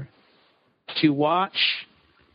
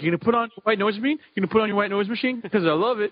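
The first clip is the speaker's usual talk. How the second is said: eager rushed asking; much faster than usual. Retold or imitated. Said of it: "I'm like".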